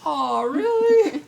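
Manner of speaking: high, loud, drawn-out mock gushing; exaggerated sing-song "aw"; mock touched. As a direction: stretch it out in a squeaky pretend swoon. Playing along with backhanded compliment.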